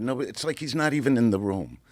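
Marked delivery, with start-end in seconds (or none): none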